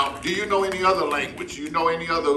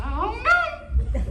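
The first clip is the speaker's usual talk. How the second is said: slow, drawn-out, very high hum; doubtful parrot; slower than usual. Acted. Parrot puppet balking at a large word, slow high mumble. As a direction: mumble a long high "I don't know" in parrot voice.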